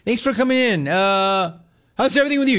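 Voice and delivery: high, bubbly fake-friendly greeting; cheery clerk voice. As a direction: put on a chirpy service voice. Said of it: "If somebody's like".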